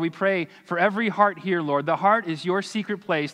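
ordinary talk

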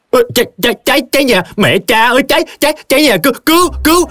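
very high, panicked cries of fire; startled yelling. Acted. Drenched tea seller screams that the house burns, startled yelling. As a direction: shriek in rapid repeats, pitched far up. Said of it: "làm cho bà ta giật mình la lớn".

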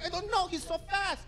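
very high, helpless whimper of the beaten runner; whiny runner. Retold or imitated. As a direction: sound hopeless trying to catch him. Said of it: "He's like".

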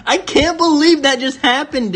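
high, thrilled disbelief; excited disbelief. Real, not acted. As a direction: cry it out in amazement. Still in disbelief at pull.

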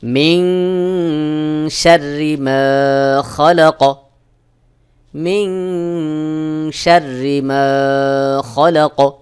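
very slow chanted recitation with ghunnah; slow Quran recitation. Shown as a demonstration. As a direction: draw out each word in tajweed. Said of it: "সহি উচ্চারণ হলো". (ধীরে তাজবীদসহ তিলাওয়াত)

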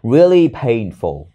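slow, careful teacher read of really painful; slow model reading. Shown as a demonstration. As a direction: read "really painful" slowly, stretching each word. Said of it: "Còn quá khứ là that was". (đọc chậm mẫu "really painful")

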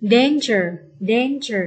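"danger" with a clear DAN-; stress on first syllable. Shown as a demonstration. Teacher showing which syllable is stressed, stress on first syllable. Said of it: "nhấn âm một".

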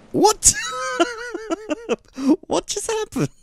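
high laughing disbelief; baffled laugh. Real, not acted. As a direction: squeak out a baffled what. Baffled by result.

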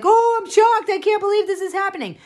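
high, hammed-up gasp of fake outrage; fake shock. Mocking the elites' fake shock in a high squeal. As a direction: camp it up as a fake-shocked snob. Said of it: "fake, like".

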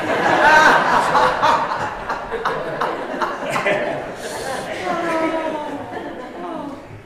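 long loud high laugh; real laughter; big laugh. Real, not acted. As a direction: crack up at your own joke. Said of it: "laughs at own joke".

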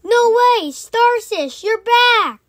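high, loud shocked cry; surprised. Acted. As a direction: gasp it out in disbelief. Friend returns from dead.

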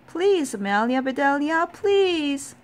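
high, loud begging; pleading shout. A narrator's reading. Worried team begging the batter at last pitch, high pleading shout. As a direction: shout a desperate plea to the batter. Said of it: "shouted the Grizzlies".

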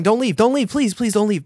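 high, loud, frantic begging in jest; mock pleading. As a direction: playfully beg them to stay, words tumbling out. Begging viewers to stay.

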